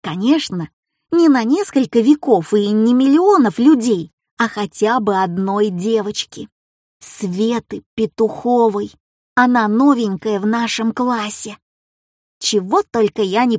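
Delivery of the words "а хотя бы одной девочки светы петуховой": plain reading voice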